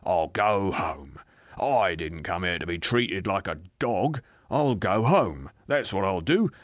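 bitter, blustering refusal; defiant ghost. Acted. Ghost refusing the spirit's offer, defiant. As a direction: dig in and threaten, leaning on key words.

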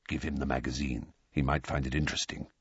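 low, hushed secret aside; whisper. A narrator's reading. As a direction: drop to a confidential near-whisper. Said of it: "in a whisper".

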